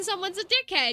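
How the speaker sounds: usual speaking voice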